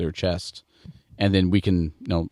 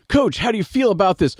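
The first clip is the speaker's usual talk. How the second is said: high, pushy sideline reporter question; mock the reporter sticking a mic in a face; raised, prodding reporter voice; 12 semitones higher, 3 dB louder than usual. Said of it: "they're like".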